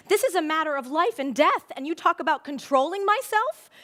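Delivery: high, loud, rising outrage; indignant yell. A narrator's reading. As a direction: passionate child's protest, acted at full voice. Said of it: "yelled Fern".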